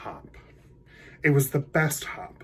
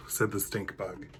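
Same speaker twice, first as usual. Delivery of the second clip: ordinary delivery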